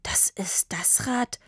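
hushed, slow, breathy disbelief; breathed. A narrator's reading. Papa recognises the bike from the shop, breathed. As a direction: breathe it out in awe. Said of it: "hauchte Papa".

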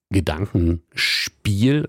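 "Gedankenspiel" drawn out slowly with weight on "spiel"; slow "Gedankenspiel", last part "spiel" stressed. Real, not acted. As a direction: lean on the "spiel" in "Gedankenspiel", stretching the word. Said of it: "Betonung auf Spiel".

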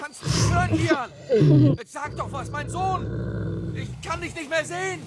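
high, worried calling from a distance; anxious calling. Acted. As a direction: call down desperately for your child. Father calls down for missing child.

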